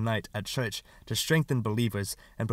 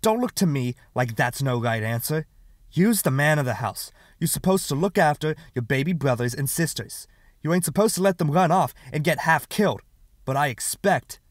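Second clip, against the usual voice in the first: scolding lecture; 5 semitones higher, 6 dB louder than usual. Acted. Neighbor lectures boy.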